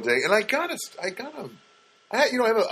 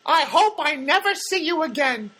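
much higher, chirpy mock of Cheryl; Cheryl's parting line; 3 dB louder than usual. Cheryl saying goodbye to him, high and sing-song. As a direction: sing it out like a cheery therapist. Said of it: "the last thing she said to me is".

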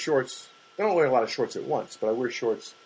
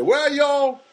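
mocking bigot; 7 semitones higher, slower than usual. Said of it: "somebody came and said".

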